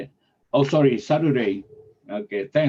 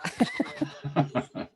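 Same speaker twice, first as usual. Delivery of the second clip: soft breathy laugh; 12 semitones higher, 8 dB quieter than usual. Real, not acted. Host laughs at many members.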